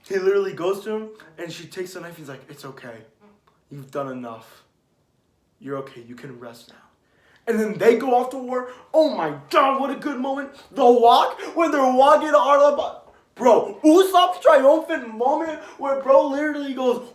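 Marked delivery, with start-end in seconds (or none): 2.5-6.8 soft, low and soothing; hushed, tender imitation of comforting; gentle reassurance. Imitating villager soothing Nami. 7.5-17.1 loud, high-pitched fan excitement; real over-the-top enthusiasm; hyped-up gushing. Real, not acted. Hyped fan recounting battle moments.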